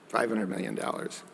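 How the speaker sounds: murmured in wry disbelief; quiet ironic echo. Real, not acted. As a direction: let it sink in with a quiet repeat. Echoing the figure in disbelief.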